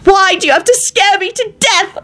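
very high, frightened scolding shriek. A narrator's reading. Elizabeth as Lupin briefly opens his eyes, shrieking. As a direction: shriek it, pitched right up. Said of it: "Elizabeth shrieked".

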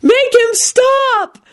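high, very slow shriek; women shrieking at the din. A narrator's reading. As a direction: beg over the noise. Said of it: "put their hands over their ears and shrieked".

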